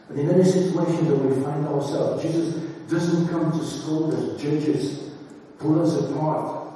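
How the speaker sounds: usual speaking voice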